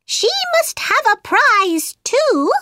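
high-pitched mouse character. A narrator's reading. Mouse speaking up for Alice, high mouse voice. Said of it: "the mouse pointed to Alice and said".

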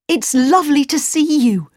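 high, bright greeting with a smile; cartoon princess. A narrator's reading. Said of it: "cried the princess with a big smile".

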